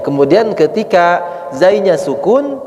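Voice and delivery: usual speaking voice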